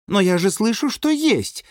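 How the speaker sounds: high-pitched objection; protesting woman. A narrator's reading. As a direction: protest in a high voice, rising at the end. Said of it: "возразили ворота". (возражает высоким голосом)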